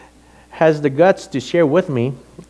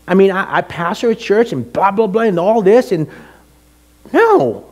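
high, indignant ranting; worked-up retold complaint to God; bitter protest; 7 semitones higher than usual. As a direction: protest high, building to an emphatic no. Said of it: "my attitude was like".

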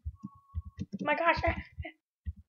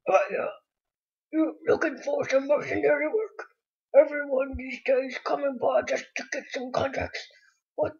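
zombie voice; 3 semitones higher, 5 dB louder than usual. Acted. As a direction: read the NPC as a zombie. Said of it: "I'm gonna say it zombie voice".

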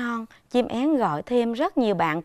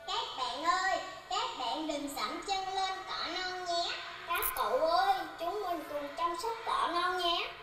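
put-on much higher, much softer child's voice; a small pupil gently urging friends; child's message; 8 semitones higher, 11 dB quieter than usual. Pupils speaking as the swallow to other children, soft and high. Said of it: "Con có thể nói".

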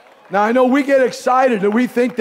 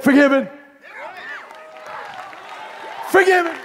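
voice of God; 11 semitones higher than usual. Retold or imitated. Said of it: "God said".

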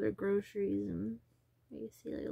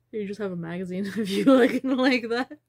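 much louder, laughing mid-line; 13 dB louder than usual. Real, not acted. Telling Kelly about being on a magazine, laughing loudly. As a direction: gush through a laugh.

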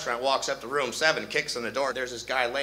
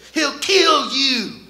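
high, slow, drawn-out alarm; mock panic; 10 semitones higher, 6 dB louder, much slower than usual. Wife warning lover of husband, high panicky wail.

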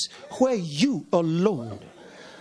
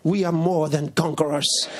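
usual speaking voice

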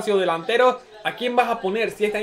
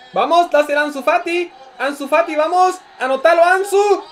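rising, loud live urging; excited urging; 9 semitones higher, 5 dB louder than usual. Real, not acted. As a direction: egg the striker on. Urging player before goal.

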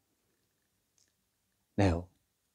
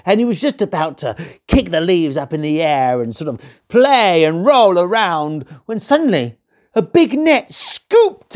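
climbing much higher and louder; rising excitement; 10 semitones higher, 6 dB louder than usual. A narrator's reading. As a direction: build the suspense to the net. Narrator builds to the trap.

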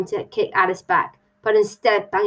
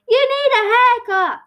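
high, loud call; 5 dB louder than usual. Acted. Hatter calls to the stranger, high and loud. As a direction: blurt it out high over the chatter.

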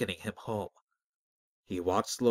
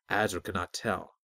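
ordinary delivery

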